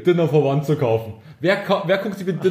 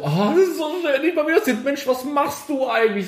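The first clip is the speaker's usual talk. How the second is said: gushing high voice; 10 semitones higher than usual. Retold or imitated. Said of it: "alle so".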